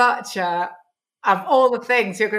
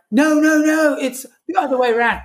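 correcting writer; 7 semitones higher, 3 dB louder than usual. Retold or imitated. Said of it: "And the writer went".

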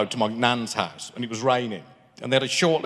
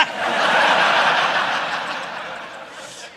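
long silent wheezing laugh; airy wheeze after the punchline; breathless laugh; 4 dB louder than usual. Real, not acted. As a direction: laugh with no voice, just air. Laughs at his own story.

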